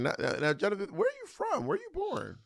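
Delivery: high, soft probing questions; mock interrogation. Host grilling the guest on his birthplace, high and soft. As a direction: squeak up the questions in disbelief.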